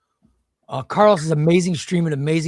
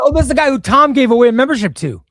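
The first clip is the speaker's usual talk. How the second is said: excited; 8 semitones higher, 11 dB louder than usual. Real, not acted. Recognizing the winner.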